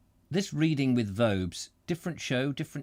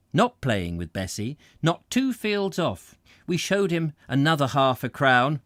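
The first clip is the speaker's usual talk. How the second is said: brisk read-aloud narration; story narration; 3 semitones higher, 5 dB louder than usual. A narrator's reading. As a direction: carry on with the tale. Reads the book again.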